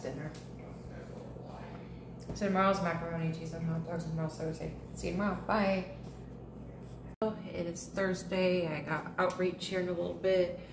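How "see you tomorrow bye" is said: ordinary talk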